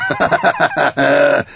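big booming laugh; hearty wildling laugh; loud mocking laugh. A narrator's reading. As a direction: laugh in his face. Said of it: "Tormund lachte".

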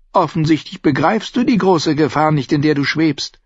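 angedeuteter Tyrion, drängend, lauter bei hör mir zu; will sie endlich zum Zuhören bringen; Tyrion, beschwörend. Acted. Tyrion warnt Shay eindringlich. (Tyrion warnt Shay, drängend und etwas lauter)

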